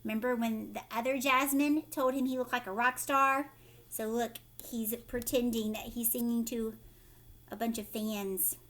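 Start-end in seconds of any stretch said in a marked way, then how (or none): none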